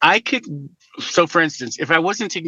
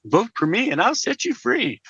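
higher-pitched, singsong mock slogan; mock campaign slogan; 7 semitones higher than usual. Candidate mimicking rivals who had no policy points, mocking singsong. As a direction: chant it like an empty campaign promise.

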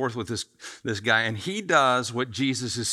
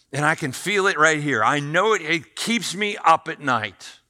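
desperate pleading; 5 semitones higher, 7 dB louder than usual. Retold or imitated. Young man on his knees telling Jesus something's missing, desperate and pleading. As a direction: act out a rich man pleading, voice straining. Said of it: "hear the quivering in his voice".

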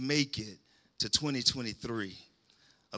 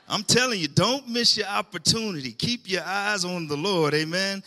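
high and loud exhortation; preaching shout; 5 semitones higher, 5 dB louder than usual. Real, not acted. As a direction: urge the crowd hard, raised up.

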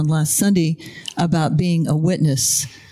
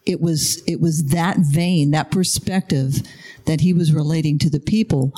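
ordinary talk

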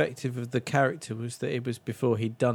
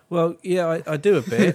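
higher and louder, laughing through it; 5 semitones higher, 7 dB louder than usual. Retold or imitated. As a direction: giggle out the reluctant admission. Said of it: "And he says".

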